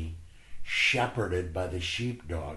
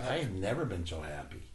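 ordinary talk, nothing marked

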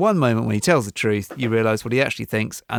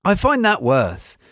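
usual speaking voice

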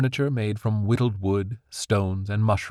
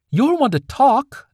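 much higher, louder, slow angry retort; 8 dB louder, slower than usual. A narrator's reading. Daughter hitting back at her mother, high and angry. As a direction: spit it back in anger. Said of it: "voice still high and charged with anger".

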